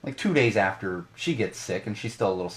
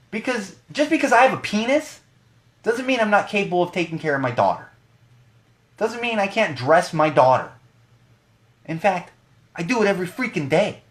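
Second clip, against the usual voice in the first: indignant outburst; 9 semitones higher, 5 dB louder than usual. Real, not acted. Indignant rant about dad stereotypes.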